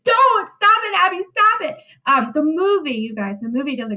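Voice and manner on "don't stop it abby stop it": high, loud frightened begging; cowering girl crying out to stop; shrill-high terrified plea; terrified pleading. Retold or imitated. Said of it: "Mary Warren is terrified, like, cowering".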